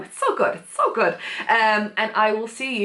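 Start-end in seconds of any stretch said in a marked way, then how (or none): 0.0-0.5 very high, squeaky gushing; gushing squeal. Real, not acted. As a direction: gush with the voice shooting up. Gushing over favourite trope.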